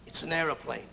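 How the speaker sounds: normal talking voice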